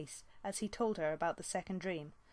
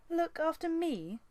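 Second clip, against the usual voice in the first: much higher, slow outraged echo; indignant; 11 semitones higher, 4 dB louder, slower than usual. Acted. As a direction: bristle at the insult. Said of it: "Squirrelpaw's eyes blazed indignantly".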